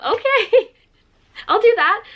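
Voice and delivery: squealed high, giddy acceptance; high, thrilled little yes; excited yes. Retold or imitated. Excited acceptance of pub invite.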